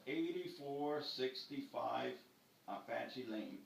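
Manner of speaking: very slow, spelling out each number; slow it right down for the dispatcher; slow, clear repeat. Retold or imitated. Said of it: "Give me that address again".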